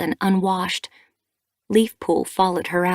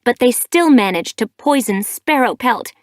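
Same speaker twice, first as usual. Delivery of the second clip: higher, louder comeback; sharp retort; 5 semitones higher, 7 dB louder than usual. A narrator's reading. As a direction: snap the point home. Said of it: "Sparkpelt shot back".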